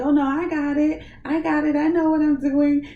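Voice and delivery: hammed-up know-it-all; mock cocky reply. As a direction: mimic her own careless insistence. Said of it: "But you were like".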